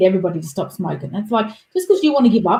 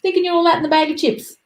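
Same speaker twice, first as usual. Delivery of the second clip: much higher, sneering put-on voice; mocking friends; 10 semitones higher than usual. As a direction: tease them like a smug old mate. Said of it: "next minute they're like".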